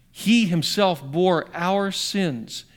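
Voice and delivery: He himself bore our sins, each word slow and weighty; slow emphasis. Shown as a demonstration. Pastor showing the congregation the emphasis in the verse, slow and weighty. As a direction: lean hard on He himself. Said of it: "that's called an emphasis".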